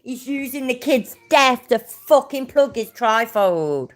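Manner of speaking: loud, high, drawn-out appalled outburst; real outraged disbelief. Real, not acted. Streamer reacting to kid's death used as a plug, outraged. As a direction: cry out aghast, stretching the words.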